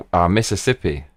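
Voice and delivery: normal talking voice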